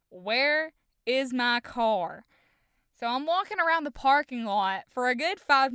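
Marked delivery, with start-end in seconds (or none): 0.1-2.2 WHERE IS MY CAR drawn out word by word; slow, spaced-out "Where is my car?", pressing for an answer; insistent demand. Retold or imitated. Repeats the demand after his denial.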